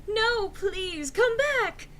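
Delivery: high, pleading call. Acted. Draco begging Enderby to return, high plea. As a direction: beg her to come back, high. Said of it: "shouted Draco sadly".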